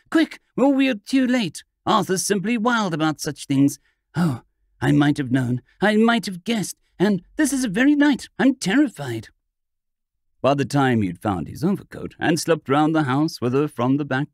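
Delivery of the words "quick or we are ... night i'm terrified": frightened pleading. A narrator's reading. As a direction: urge them on in terror, voice climbing. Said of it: "she cried, pale with fear".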